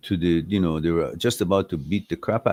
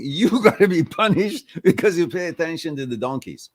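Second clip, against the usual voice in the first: laughing scolding; 8 semitones higher than usual. Real, not acted. Lion's verdict scolding through laughter.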